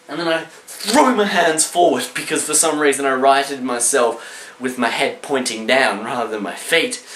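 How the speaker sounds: normal talking voice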